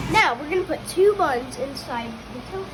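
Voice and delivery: talking loudly over a fan. Real, not acted. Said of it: "I'm sorry for the fan".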